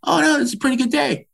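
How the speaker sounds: high, breezy imitation of the big sister; lightly voiced big sister, unbothered; a hint of a nonchalant older sister; mock sister voice. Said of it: "She goes".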